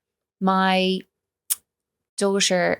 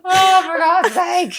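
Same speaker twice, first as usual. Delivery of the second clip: laughing exasperation; 7 dB louder, slower than usual. Real, not acted. Laughing at daughter's remark.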